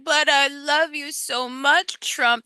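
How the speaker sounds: hammed-up tearful devotion; mock crying. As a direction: imitate a heartbroken devotee pleading. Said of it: "They're crying in their trucks".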